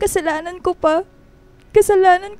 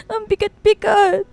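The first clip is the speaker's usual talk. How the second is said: sobbing; 2 semitones higher than usual. Acted. Dayan sobbing over family.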